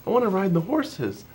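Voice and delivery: put-on high little-girl voice, pleading; two-year-old daughter. Father imitating his small daughter begging to ride the horses, high and pleading. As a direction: squeak it up like a toddler begging. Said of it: "she starts talking about how".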